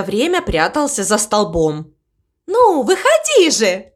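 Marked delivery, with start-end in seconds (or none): 2.5-3.9 high-pitched, mildly raised call. A narrator's reading. Cow calling the crow out of hiding, calling out. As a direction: call out impatiently, pitch jumping up. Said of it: "крикнула мама Му".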